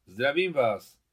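loud greeting through a forced smile. A narrator's reading. Adam greets Miťka's father at the gate, forced smile. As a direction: put on a strained smile and greet. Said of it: "s nuceným úsměvem".

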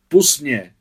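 loud, high outburst; angry protest. A narrator's reading. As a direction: shout to be let go. Hurt boy shakes Adam off.

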